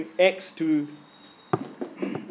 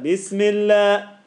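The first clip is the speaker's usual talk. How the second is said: loud, slow, higher-pitched "Bismillah"; loud recitation; 5 semitones higher, 6 dB louder, much slower than usual. Shown as a demonstration. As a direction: project it fully, drawing out each syllable. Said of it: "like that".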